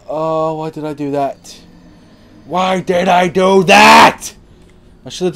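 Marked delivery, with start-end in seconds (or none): 0.1-1.6 higher-pitched whine of regret; regretful moan. Real, not acted. Regrets his mistake, first take. 2.5-4.3 slow, very loud, high yell; anguished shout. Real, not acted. Repeats it as a shout.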